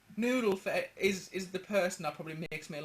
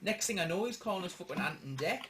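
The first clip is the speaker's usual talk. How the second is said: normal talking voice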